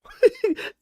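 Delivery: sudden loud high burst of laughter. Real, not acted. Streamer bursts out laughing at a chat joke, loud high laugh. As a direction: crack up hard at the joke.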